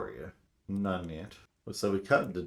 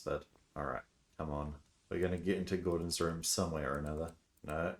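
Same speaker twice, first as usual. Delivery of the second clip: ordinary talk, nothing marked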